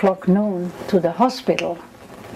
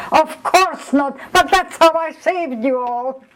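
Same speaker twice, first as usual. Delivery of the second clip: higher, laughing through the words; 8 semitones higher, 3 dB louder than usual. Retold or imitated. Raoul on the floor telling the doctor, laughing. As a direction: laugh it off, high and amused. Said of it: "hysterically laughing".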